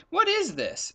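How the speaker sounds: high, puzzled leopard voice; leopard wondering. Acted. Leopard peers into dark forest, high and puzzled.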